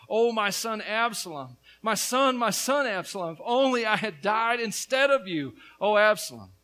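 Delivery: preacher's impassioned reading of a father's cry; crying out in grief. Acted. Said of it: "he cried out".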